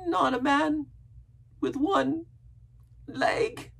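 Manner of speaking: high, halting, breaking into whisper; fearful gasp. A narrator's reading. Castaway dreading the one-legged man, gasping. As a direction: gasp it out in pieces. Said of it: "he gasped".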